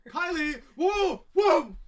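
high, loud whooping; mock excited yelling. Acting out seeing Kylie, loud high yells. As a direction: yell the name, then whoop at full volume.

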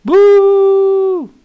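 long, high, loud boo. Real, not acted. Streamer jeering a bad pack, loud drawn-out boo. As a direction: boo it at full voice like a crowd.

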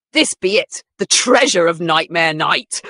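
loud triumphant pirate. Acted. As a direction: crow it like a pirate finding gold. Pirate character voiced.

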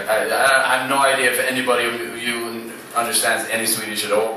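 ordinary delivery

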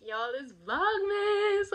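mock intro catchphrase. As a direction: sing out the show opener. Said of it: "not being like".

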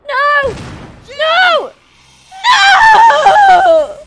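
rising high loud cries; real desperate screaming; desperate screams. Real, not acted. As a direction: scream no in despair. Companion dying again.

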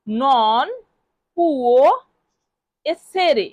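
each word apart with long pauses. Shown as a demonstration. Teacher breaking the phrase apart for students, word by word. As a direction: give the class one word at a time. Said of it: "এই বাক্যটিকে আমরা Italian ভাষায় বলবো".

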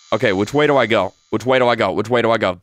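ordinary talk, nothing marked

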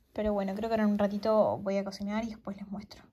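soft breathy whisper. Real, not acted. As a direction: whisper it as an aside. Quiet aside after long work.

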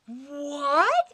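loud rising disbelief; shocked. Acted. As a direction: react as if hearing terrible news. Shocked villain reaction.

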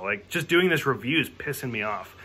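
normal talking voice

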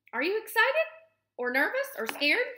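high, loud squirrel questioning; excited high-pitched little squirrel quizzing a friend; eager squirrel. A narrator's reading. Squirrel quizzing the gosling in story.

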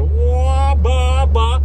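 loud, high-pitched exhaust pops by mouth; imitated engine roar. Telling viewers what he heard behind him, imitated engine noise. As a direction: mimic a car roaring up behind you.

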